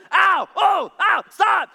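high, loud mock yelps of pain. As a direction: cry out as the chisel scrapes your feet. Pain during pedicure scraping.

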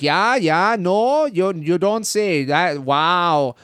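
put-on high-pitched, drawn-out U.P. Midwest accent; sing-song Upper Midwest accent, vowels stretched; Ned Flanders. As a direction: imitate two U.P. locals chatting in their accent. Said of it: "exactly like Ned Flanders".

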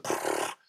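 soft blown-lip spurt of water; fire hose noise. Retold or imitated. Showing a motor mouth's torrent, imitated hose spray. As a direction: imitate a gushing hose with a quiet pfft. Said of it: "drinking from a fire hose".

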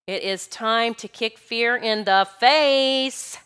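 very slow, emphatic build; battle cry in slow motion; drawn-out rallying cry. Real, not acted. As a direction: stretch each word, landing on a long FACE. Rallying cry closing the talk.

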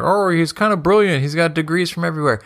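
mimicking fans who praise Franco, gushing and high; mocking fans. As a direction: parody someone gushing over him. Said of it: "when people be like".

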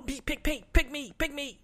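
high, squeaky begging to be picked; eager pleading. Pretend. Volunteering for the next favorite moment, high eager pleading. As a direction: beg like a kid to be chosen.

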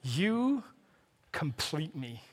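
soft, slow film-romance declaration; hushed, drawn-out movie line with pauses between words; quoted film line. Retold or imitated. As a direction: say it softly, like a tender movie confession. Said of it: "Jerry Maguire".

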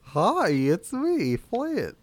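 put-on slow, high Irish accent; drawn-out silly Irish accent. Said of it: "Let me hear you do a, uh, Irish accent".

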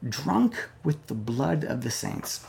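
put-on Drunk with the blood of the saints repeated softly and gravely; hushed repeat. Shown as a demonstration. Preacher quietly repeating the verse for his church to weigh. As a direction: drop it to a hush for weight. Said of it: "Church, that is telling".

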